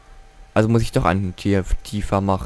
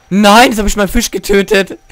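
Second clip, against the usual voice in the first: high, loud no turning into laughter; shocked at own blunder, then laugh; dismayed laughing cry; 9 semitones higher, 8 dB louder than usual. Real, not acted. Gamer accidentally kills his fish, high laughing outcry.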